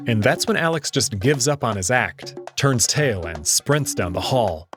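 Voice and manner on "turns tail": ordinary delivery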